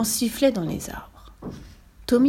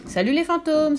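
high, cheerful call into the dark; 7 semitones higher than usual. A narrator's reading. Fifi greeting the ghosts in the attic, high call. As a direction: hail the ghosts brightly. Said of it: "s'écria Fifi".

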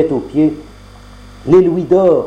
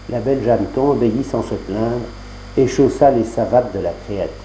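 usual speaking voice

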